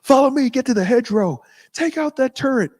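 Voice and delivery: high, urgent battlefield order; Sergeant Randall. Acted. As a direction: call out commands over combat. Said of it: "Sergeant Randall in one of the Call of Duties".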